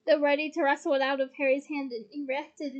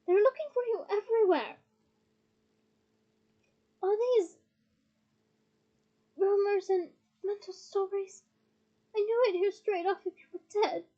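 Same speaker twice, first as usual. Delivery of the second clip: raised, insistent pleading; bellowed defence; 6 semitones higher, 4 dB louder than usual. A narrator's reading. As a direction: press your case over objections. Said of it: "bellowed Ron".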